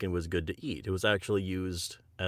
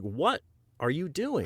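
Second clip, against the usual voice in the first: imitating shock; 8 semitones higher than usual. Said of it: "He's like".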